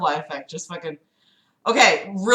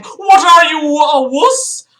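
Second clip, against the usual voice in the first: loud, higher taunting bully; mock bully shout; 6 semitones higher, 7 dB louder than usual. Reading Philip's taunt aloud as a loud mock bully. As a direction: yell it like a playground thug picking a fight. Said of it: "Philip kept interrupting with statements like".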